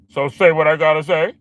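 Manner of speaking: mocking imitation. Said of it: "That's probably how you gonna respond".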